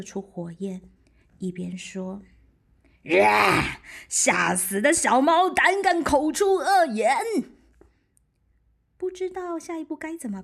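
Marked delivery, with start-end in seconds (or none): 3.2-7.2 loud, high sneering monster; huge beast snorting contempt; scornful dragon roar. Acted. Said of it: "巨龙嗤之以鼻".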